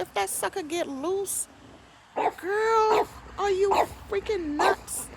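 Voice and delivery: high alarmed warning, slowing on freaking nuts; alarmed protest. Retold or imitated. Warning the neighbor the snake could escape, high and alarmed. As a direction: sound panicked at the idea. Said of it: "I said".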